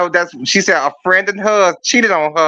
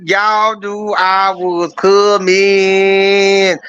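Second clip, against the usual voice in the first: drawn out slow; much slower than usual. Acted. Host's entrance, drawn-out repeat.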